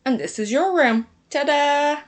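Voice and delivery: loud, high, proud ta-da; excited reveal. A narrator's reading. Lily showing her sister the new room, excited. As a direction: present it with a flourish. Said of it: "She was so excited to show her sister".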